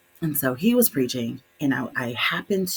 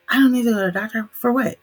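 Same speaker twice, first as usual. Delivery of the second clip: higher, louder and fast mock dad protest; an imitated dad, pitched up and quick, brushing off the doctor; stubborn dad; 10 semitones higher, 8 dB louder, faster than usual. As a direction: rattle off the refusal like an offended father.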